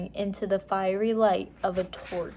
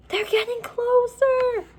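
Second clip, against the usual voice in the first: put-on much higher, slow girl's call; Annie warning; 4 dB louder than usual. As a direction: warn him from across the dark room. Annie warning from far away.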